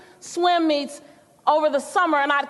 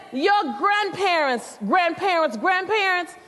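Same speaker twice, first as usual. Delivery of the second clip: slow, higher, repeating grandparents with weight; preaching grandma; 4 semitones higher, slower than usual. Retold or imitated. Grandma lecturing her teen granddaughter, slow and higher. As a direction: drive "grandparents" home each time.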